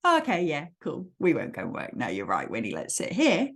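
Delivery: low, easygoing giving in; indulgent agreement. Acted. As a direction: go along lazily, voice dropped. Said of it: "We don't just indulge him and go".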